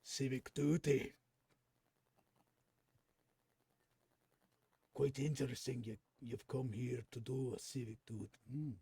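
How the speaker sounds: an Irish lilt that slides toward Scottish; half-found Irish accent with a Scottish edge; Irish accent drifting Scottish. Acted. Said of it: "You're currently Scottish if you're going for Irish".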